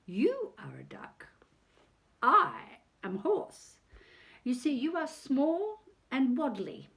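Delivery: a proud horse, slow and high at times; lightly voiced noble horse talking down to the duck; mild snooty horse voice; haughty horse. A narrator's reading. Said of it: "the horse snorted".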